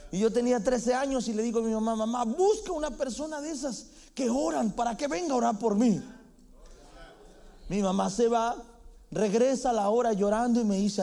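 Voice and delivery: loud, driving sermon delivery, pushing each phrase out; preaching at full force. Acted. Pastor telling his cancer testimony to the congregation, loud preaching. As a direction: preach it hard to the church, building to loud peaks.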